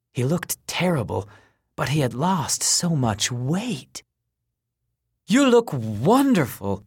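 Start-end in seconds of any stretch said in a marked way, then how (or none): 5.3-6.8 slow, high eager blurt. A narrator's reading. Kenny amazed at his old friend's weight loss, blurting slowly. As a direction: gush it out, amazed. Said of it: "Kenny blurted".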